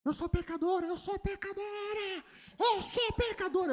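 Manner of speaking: hammed-up stubborn sister repeating herself; whiny sister insisting. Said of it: "Ficou com raiva". (irmã teimosa imitada com voz bem aguda e chorosa)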